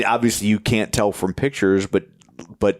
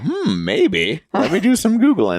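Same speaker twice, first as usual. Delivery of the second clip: higher, mock curious voice; imitating co-host; 9 semitones higher than usual. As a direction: playfully voice your friend's musing. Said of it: "Now you're like".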